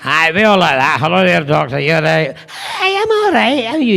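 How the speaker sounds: mock Scottish babble between Doctors; incomprehensible Scottish accents. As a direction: play several Scots greeting each other unintelligibly. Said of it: "We'd be incomprehensible".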